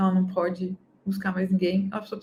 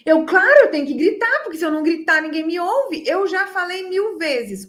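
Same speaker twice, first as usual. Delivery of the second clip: higher, louder exasperated parent who yells; mock yelling parent; 7 semitones higher, 5 dB louder than usual. Impatient parent justifying shouting at her child, higher and louder. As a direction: play the fed-up parent who shouts to be heard.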